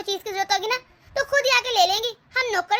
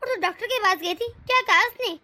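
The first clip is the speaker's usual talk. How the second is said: ordinary delivery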